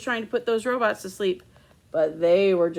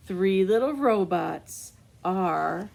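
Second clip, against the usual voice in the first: ordinary delivery, nothing marked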